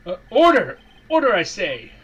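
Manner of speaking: loud judge calling order; booming judge. Acted. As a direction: bellow for order in court. Voicing the judge with gavel.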